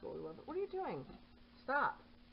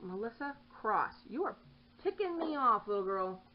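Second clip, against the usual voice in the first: louder, higher mock-stern scold; scolding the cat; 5 semitones higher, 6 dB louder than usual. Real, not acted. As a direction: tell off a naughty cat by full name. Scolding cat by full name.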